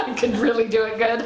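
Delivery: laughing through the words. Real, not acted. Confessing she was a good panicker, laughing. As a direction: giggle while admitting it. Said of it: "I was a good panicker".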